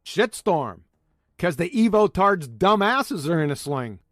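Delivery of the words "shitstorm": ordinary delivery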